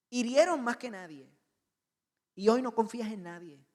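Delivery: loud, driving sermon delivery; forceful preaching. Acted. Preacher pressing the congregation about betrayal, loud and urgent. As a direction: press the pain home to the congregation.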